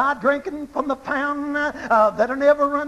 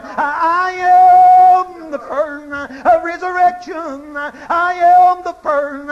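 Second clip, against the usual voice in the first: fervent proclamation; 3 semitones higher, 5 dB louder than usual. Real, not acted. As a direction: proclaim each I am as a rising cry. Jesus quote at preaching peak.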